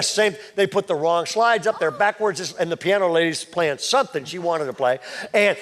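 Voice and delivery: ordinary talk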